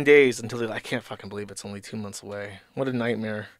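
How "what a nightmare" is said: ordinary delivery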